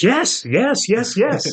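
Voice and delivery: high-pitched mock-eager rapid yeses; eager, excited imitation. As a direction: imitate someone overjoyed to be asked. Eager churchgoer delighted by the request.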